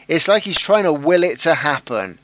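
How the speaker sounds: genuinely thrilled, a notch up; excited. Real, not acted. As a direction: get worked up over the result. Excited host at draw result.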